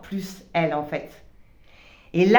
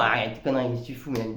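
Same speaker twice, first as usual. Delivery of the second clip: much lower, softer, contemptuous scolding; scornful ego voice; 10 semitones lower, 7 dB quieter, much faster than usual. Retold or imitated. Her cynical ego telling Mélanie off, in a low scornful voice. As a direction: scold yourself like a cynical inner critic. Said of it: "la partie un peu genre".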